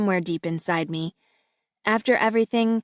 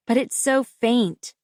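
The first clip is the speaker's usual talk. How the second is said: ordinary delivery, nothing marked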